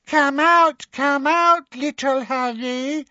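high, sing-song taunting call; mocking baby talk, pitched high. A narrator's reading. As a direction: coo tauntingly like talking to a baby. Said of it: "she called in her mock baby voice".

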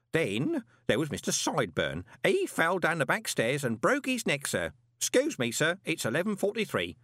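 put-on high, chatty old clerk; fussy old postal clerk; old man voice. Groat's voice in dialogue.

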